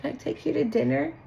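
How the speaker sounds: high, soft coaxing invitation; sweet-talking, hushed and needy; mock pleading ex. Ex begging to take you to dinner, soft coaxing. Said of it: "throw in the little".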